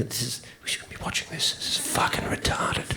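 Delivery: hushed whisper with a laugh; whispered aside. Acted. Comic whispering to the crowd that the show is dumb. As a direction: confide it under your breath.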